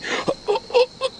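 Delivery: high sharp scandalized gasp; mock gasp. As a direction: gasp like you just caught them. Shocked by girl's comment.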